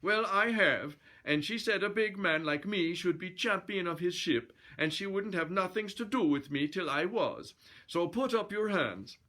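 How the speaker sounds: high-pitched mock-Swedish bluster; indignant Swedish challenger voice; Swedish brute. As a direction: insist in a comic Swedish accent.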